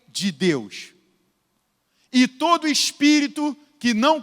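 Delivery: measured preaching read of the verse; scripture reading. Acted. Preacher reading scripture to the congregation, measured. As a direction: read the text out as a preacher to the church.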